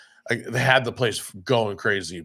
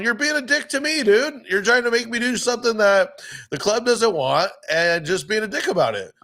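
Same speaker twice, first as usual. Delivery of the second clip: much higher, heated reply to a pushy patron; exasperated retelling; 11 semitones higher than usual. Retold or imitated. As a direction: replay the blow-up with rising exasperation.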